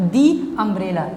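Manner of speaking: long "ee" in "the", leading into "umbrella". Shown as a demonstration. Teacher reading an example with a vowel sound, "the" pronounced दी. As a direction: slowly say "the" as "dee" before "umbrella". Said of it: "हम इसको दी बोलेंगे".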